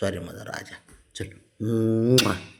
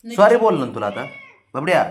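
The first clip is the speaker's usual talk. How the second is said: higher, louder insistent sorry with a rise on sorry; loud pleading apology; 7 semitones higher, 5 dB louder than usual. Real, not acted. Owner pleading with his sulking dog, loud insistent sorry. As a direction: push the apology, a bit exasperated.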